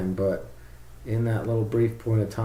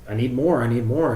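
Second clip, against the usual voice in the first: louder, higher, insistent plea; demanding pid loop; 4 semitones higher, 7 dB louder than usual. Pretend. Pid loop demanding more from the motors, insistent and louder. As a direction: play a control loop pleading for more. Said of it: "the pid loop frequency is saying".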